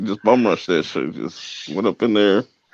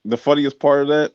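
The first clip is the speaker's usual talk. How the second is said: ordinary talk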